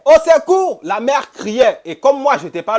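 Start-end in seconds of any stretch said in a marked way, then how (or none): 0.0-0.8 high, loud panicked cries for help; screaming for help. Retold or imitated. Mother calling out from the house, frantic loud cries for help. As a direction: yell for help as if in danger. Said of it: "elle crie".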